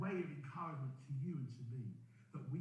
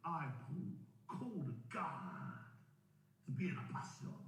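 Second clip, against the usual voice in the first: mock American accent; 2 semitones lower than usual. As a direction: play Paul grandly in an American accent, stretching the words. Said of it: "I do it in an American accent".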